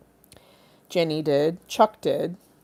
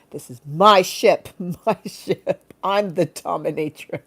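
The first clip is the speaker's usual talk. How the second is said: loud slow proud declaration, then laughing; commanding dominatrix; 5 dB louder, slower than usual. Pretend. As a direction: claim the ship like a captain. Dominatrix bit continues.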